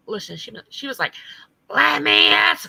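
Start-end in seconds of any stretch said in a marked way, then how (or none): 1.7-2.7 loud, high mocking imitation of her. Mocking the streamer's panel question, loud and high. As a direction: ham up her shrill question. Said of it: "let me try to do her voice".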